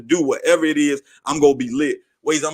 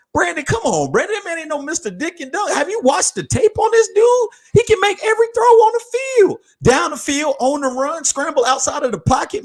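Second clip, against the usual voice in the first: much higher, squeaky outraged disbelief; excited disbelief; 11 semitones higher than usual. Real, not acted. Host to co-host Brandon defending Bryce Young, shrill excited disbelief. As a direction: yelp at Brandon like he's lost his mind. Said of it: "I am too fucking excited right now".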